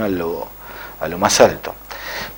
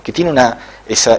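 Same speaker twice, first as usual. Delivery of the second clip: plain reading voice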